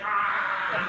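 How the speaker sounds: sharp strangled gasp; loud choked cry; mock choking gasp. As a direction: gag on something heavy going down. Choking on heavy medicine.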